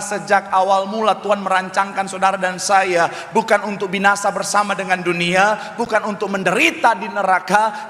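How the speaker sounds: preaching. Real, not acted. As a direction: drive it home to the congregation. Preaches at full force. (khotbah berapi-api dengan nada tinggi)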